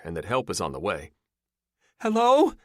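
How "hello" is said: high, loud rising call; trapped woman answers, yelling; woman yelling back. A narrator's reading. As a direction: shout back, scared but strong. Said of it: "she yells back".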